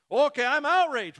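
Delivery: loud, high blustering outrage; mock outrage. Preacher parodying the bumper-sticker reader, mock outrage. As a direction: burst out as if outraged, hammed up. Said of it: "leaves you to say ... outraged".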